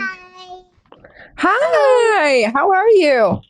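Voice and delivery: high sing-song hello; cute high greeting. Real, not acted. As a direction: greet a little one sweetly. Greeting someone on camera.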